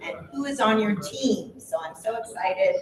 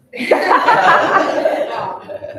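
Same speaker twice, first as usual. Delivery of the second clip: loud laugh; 10 semitones higher, 11 dB louder than usual. Real, not acted. Host laughs between introductions.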